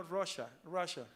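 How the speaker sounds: "Russia, Russia" said the English way, RUSH-a with a sh sound; demonstrating the English word "Russia" for the class; English pronunciation. Shown as a demonstration. Teacher shows students how Russia is said in English. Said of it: "Cómo se dice en inglés".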